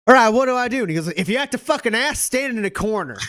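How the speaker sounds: high, loud, rushed voice; frantic rush. Acted. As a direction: burst in breathless and urgent. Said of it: "rushes in and is like".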